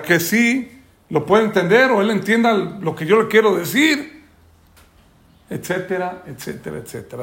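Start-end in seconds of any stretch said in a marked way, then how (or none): 5.5-7.2 each etcetera softer and lower until it is almost gone; fading etcetera. Shown as a demonstration. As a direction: let the list die away to nothing. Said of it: "trailing repetition".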